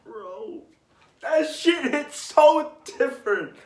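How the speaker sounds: real emotional high-pitched cry-out; overwhelmed gush. Real, not acted. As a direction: let the feeling burst out of you. Fan overwhelmed by sad arc.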